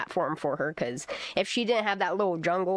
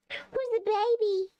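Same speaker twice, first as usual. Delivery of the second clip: very high, slow coo; slower than usual. Real, not acted. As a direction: sing it out to the kitty. Cooing to cat.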